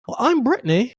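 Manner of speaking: high-pitched imitation of a British accent; thick British accent. Imitating a girl introducing herself in London, British accent. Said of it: "have a thick-ass British accent".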